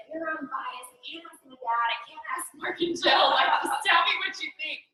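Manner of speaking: rising from plea to near shout; urgent plea building to a shout. Retold or imitated. Pleading for advice, rising.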